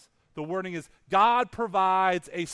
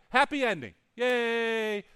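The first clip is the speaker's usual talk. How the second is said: mock cheer; 3 semitones higher, slower than usual. Mocking the assumed happy ending.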